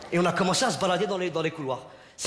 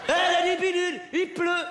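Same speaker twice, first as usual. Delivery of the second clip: mocking taunt; 9 semitones higher than usual. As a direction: shout it down at them. Taunt while spitting down.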